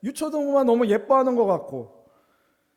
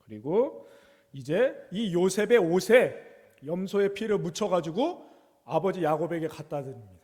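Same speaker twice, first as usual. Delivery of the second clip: normal talking voice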